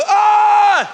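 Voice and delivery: loud, high "ah" of awe; sudden high shouted gasp of worship; awed outcry. Acted. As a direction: cry out as if overwhelmed by what you see. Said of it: "the twenty-four elders go".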